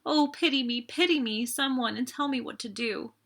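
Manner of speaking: high, pleading through tears; sobbing plea. A narrator's reading. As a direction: beg for pity as she weeps. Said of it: "sobbing wildly".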